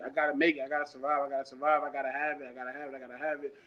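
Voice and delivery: fading to a near-whispered chant, speeding up; a mantra that dies away into a low murmur; inner survival mantra. Retold or imitated. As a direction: repeat it to yourself until it nearly vanishes. Survival mantra in his head.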